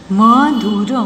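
Madhur stretched into separate sounds; syllable by syllable. Shown as a demonstration. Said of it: "properly बोलना है". (मधुर को तोड़-तोड़ कर)

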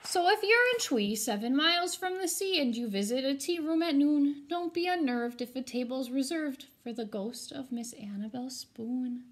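put-on lively rhyming read-aloud for kids; storybook reading. A narrator's reading. Teacher reading a rhyming ghost story to her students, animated. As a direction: read it to the class with bounce and drama.

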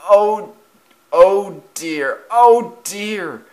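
high, loud moans of dismay; groaning. A narrator's reading. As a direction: moan loudly after a knock. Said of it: "groaned eight seven five four six".

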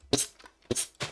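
psst whispered to lure an animal; susurro. Real, not acted. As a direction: hiss softly to call it over. (siseo susurrado)

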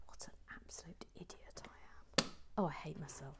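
hushed, deflated mutter to self; muttered self-reproach. Real, not acted. Host berating herself after the blunder, hushed self-reproach. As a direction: drop to a near-whisper, scolding yourself.

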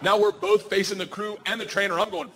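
normal talking voice

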